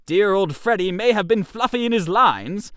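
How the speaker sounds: plain reading voice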